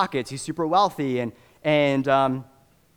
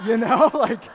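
laughing; 9 semitones higher, 5 dB louder than usual. Real, not acted. Laughing retelling of Tesla doors.

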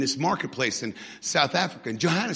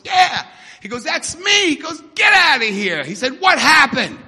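high, loud acted astonishment; excited exchange; 11 semitones higher, 11 dB louder than usual. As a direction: burst out in a shouted exchange. Acts astonished pastor and excited convert.

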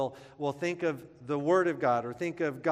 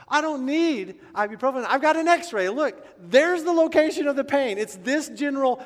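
much higher, much louder melodramatic wail; hammed-up agony; 10 semitones higher, 10 dB louder than usual. Telling girlfriend's mom about his pain, melodramatic wail. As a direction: overact the pain shamelessly.

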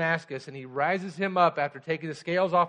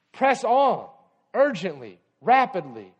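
urgent shout; 4 semitones higher, 7 dB louder, slower than usual. Acted. Preacher exhorting, loud.

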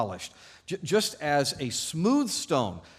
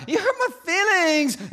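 put-on very high, loud childish wail; hammed-up hurt outcry; crying out; 8 dB louder than usual. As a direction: wail it like a wounded child, drawn out. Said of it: "or cry out".

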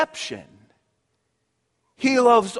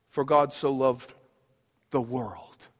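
hushed scripture; 9 semitones lower, 6 dB quieter than usual. Real, not acted. Quoting John 3:16 hushed.

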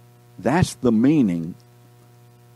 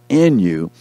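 louder "in" after a beat; stress on IN; 6 dB louder than usual. Real, not acted. Preacher reading promise to class, stressing "in". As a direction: punch "in", let "you" fall. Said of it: "The emphasis is being in".

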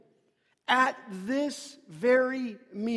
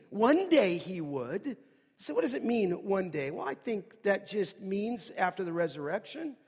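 usual speaking voice

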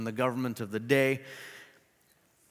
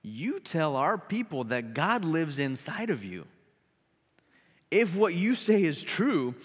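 slow, higher challenging taunt; taunting governor; 5 semitones higher, 3 dB louder than usual. Acted. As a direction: throw her faith back at her. Governor taunting her faith.

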